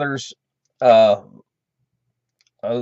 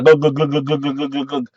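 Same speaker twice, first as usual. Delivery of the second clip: imitated glugging. Storyteller mimics Jimmy downing more vodka, imitated glugs. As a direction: run of glugs sinking away in level.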